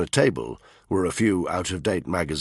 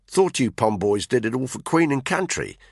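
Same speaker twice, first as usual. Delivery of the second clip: higher-pitched Australian drawl; Aussie drawl, pitched up; 7 semitones higher than usual. A narrator's reading. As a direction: needle them in an Australian accent. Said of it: "Caitlin said in her Aussie drawl".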